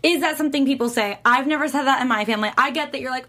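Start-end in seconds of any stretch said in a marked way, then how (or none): none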